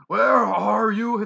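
loud, drawn-out monster roar; a giant creature roaring for Belle. Acted. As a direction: bellow it across the room. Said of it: "the voice roared again".

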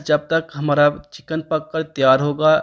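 normal talking voice

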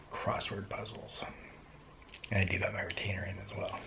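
soft breathy confession; whisper, barely there. Real, not acted. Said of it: "I don't know why I'm whispering".

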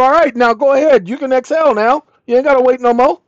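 mock sassy reprimand. Imagined Whitney voice.

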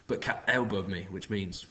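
usual speaking voice

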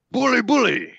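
cheery high British "bully, bully"; British accent. Pretend. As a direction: toff British exclamation. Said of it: "in, like, a British accent".

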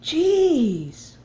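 high, loud startled jeez; surprised shriek. Real, not acted. Gamer startled by the screen, high surprised yelp.

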